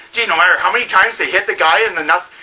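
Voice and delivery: ordinary talk, nothing marked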